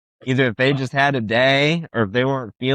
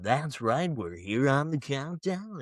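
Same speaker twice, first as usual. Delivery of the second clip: Casey Kasem voice; 9 dB quieter, slower than usual. Shown as a demonstration. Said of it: "channeling that in my radio voice".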